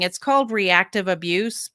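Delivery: normal talking voice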